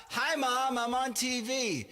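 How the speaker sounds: high, loud shout-out to mom; mock TV shout-out.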